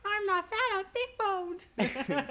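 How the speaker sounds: squeaky huffy denial; mock indignant girl. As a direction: play a huffy vain girl. Mocking a vain character.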